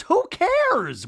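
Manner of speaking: high, loud retold brush-off; mock dismissive outburst. [name] retold brushing off the question, dismissive. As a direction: toss it out high and indignant.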